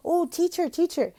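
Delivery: put-on high, excited child calling; eager student. Imitating an eager student calling the teacher. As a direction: call for teacher's attention. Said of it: "Some of them might even talk".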